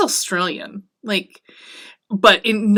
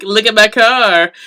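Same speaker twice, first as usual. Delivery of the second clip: a louder, proud show-off line; gearhead bragging; 7 dB louder than usual. Retold or imitated. Lestat brags about his car like a gearhead, loud and proud. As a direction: brag like a car guy. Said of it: "very much like a gearhead".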